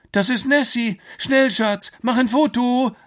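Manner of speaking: high, loud squeal of excitement; thrilled tourist calling out; excited onlooker. Acted. Onlooker excitedly calls to partner.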